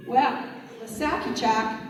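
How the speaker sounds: usual speaking voice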